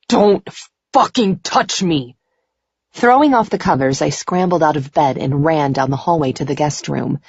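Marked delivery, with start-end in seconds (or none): 0.1-2.2 higher, strained angry cry; distressed warning. A narrator's reading. Man trapped in his nightmare, crying out. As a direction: fight them off with your voice.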